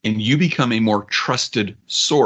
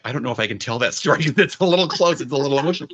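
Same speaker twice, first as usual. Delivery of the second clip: retold emotional reply; 4 semitones higher, faster than usual. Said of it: "she got a little emotional about it".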